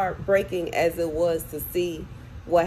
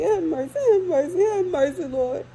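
high, louder pleading have mercy; rising cry; 11 semitones higher, 5 dB louder than usual. Real, not acted. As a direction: let the plea swell up high and loud. Plea swells into a cry.

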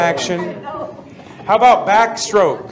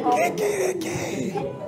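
high, slow kikiriki, much softer; softer rooster crow; 11 semitones higher, 10 dB quieter, slower than usual. Shown as a demonstration. Teacher repeats the Venezuelan rooster crow more softly. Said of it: "How do roosters crow in Venezuela?".

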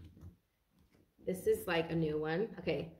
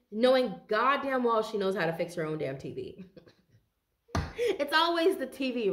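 ordinary delivery

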